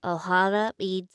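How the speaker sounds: like talking with a head cold; blocked-nose voice. Shown as a demonstration. Student attempting the character, sounding like she has a cold. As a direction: say it as if your nose is stuffed. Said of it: "I just sound like I have a cold".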